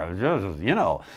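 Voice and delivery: usual speaking voice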